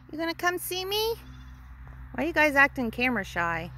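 ordinary delivery

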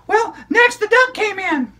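put-on high, bright child puppet storyteller; squeaky kid character excitedly telling a story; high-pitched puppet girl narrating. Puppet continues.

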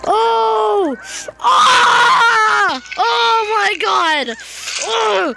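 loud, high shrieks of horror; real horrified cries; horrified screaming. Real, not acted. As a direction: scream at what you're watching. Horrified reacting to video.

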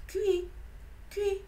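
petit cri d'oiseau aigu, lent et triste; rouge-gorge imité. A narrator's reading. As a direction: imiter le chant d'un rouge-gorge, tout doucement. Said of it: "fit à nouveau le rouge-gorge". (cui-cui d'oiseau aigu et lent)

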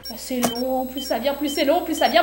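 mounting impatience. Real, not acted. Tension builds with repeated phrase.

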